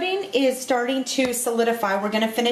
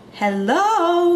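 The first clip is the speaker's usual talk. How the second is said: excited; 8 semitones higher, 4 dB louder than usual. Real, not acted. Showing off a filled puff.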